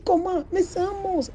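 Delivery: high, shocked insistence; horrified cry. Acted. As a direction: insist it was a monster, appalled. Woman insists it's a monster. (cri horrifié, voix aiguë)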